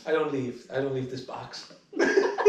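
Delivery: ordinary talk, nothing marked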